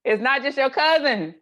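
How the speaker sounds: high, loud, lively emphasis; rising with amused energy; animated. Real, not acted. Laughing about backbiting.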